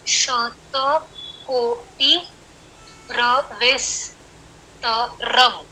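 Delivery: very slow, each syllable spaced out for counting; slow counting recitation. Shown as a demonstration. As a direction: drag out every syllable so the class can count. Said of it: "र का जो अकार है उसी के ऊपर मैंने आठ लिखा है".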